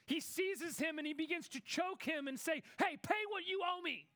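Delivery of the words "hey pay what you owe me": higher, harsh shouted demand for the money; angry demand. Acted. Servant choking fellow servant over a small debt, shouting angrily. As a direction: seize him and bark the demand.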